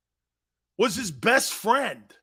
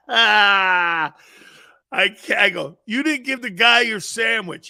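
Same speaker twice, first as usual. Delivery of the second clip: laughing; 2 semitones higher, 6 dB louder, slower than usual. Real, not acted. Laughing through retelling.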